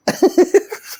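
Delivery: very high squeaky giggle; real burst of high laughter; giggling. Real, not acted. Laughs while being teased.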